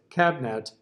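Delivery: "cabinet" squeezed into two syllables, cab-net. Shown as a demonstration. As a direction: drop the middle vowel of "cabinet". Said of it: "if the composer just gave you two notes".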